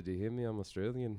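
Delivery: playful attempt at Australian; bad Australian accent. Host insists they are Australian, bad Australian accent. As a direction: put on a shaky-sounding Australian accent. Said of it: "my Australian accent".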